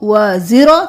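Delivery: ordinary delivery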